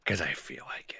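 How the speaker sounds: a deli worker snubbing the honey turkey lady in a whisper. Retold or imitated. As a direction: hush the insult like a secret. Retold snub to a customer.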